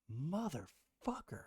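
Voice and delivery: slow, breathless whispered curse; stunned whisper. Retold or imitated. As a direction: whisper it in disbelief. Retold stunned reaction to wife.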